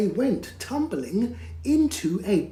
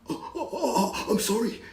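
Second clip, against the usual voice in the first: higher, laughing-scared spluttering; spluttering dragon; 5 semitones higher, faster than usual. Acted. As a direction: stammer out the apology in fright. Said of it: "he spluttered".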